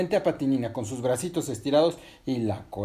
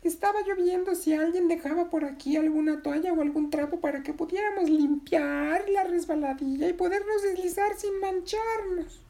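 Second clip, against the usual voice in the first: very high, squeaky character voice; high squeak, stretched out at the end; little creature voice. Acted. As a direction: talk like a tiny storybook creature. Resbalardilla speaks in the story.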